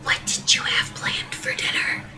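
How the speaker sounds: hushed voiceless whisper; soft husband's whisper. Acted. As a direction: breathe it as if not to disturb anyone. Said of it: "Still whispering".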